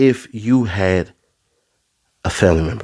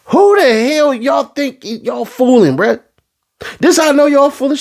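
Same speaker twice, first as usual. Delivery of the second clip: incredulous rant; 13 semitones higher than usual. Real, not acted. Ranting, voice jumps high.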